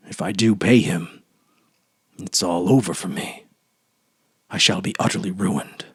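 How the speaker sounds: low, soft, brooding inner voice; banker's dark thoughts. A narrator's reading. Old banker thinking of his ruin, low and soft. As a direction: sink into the ruined banker's fears. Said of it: "The old banker remembered this and thought".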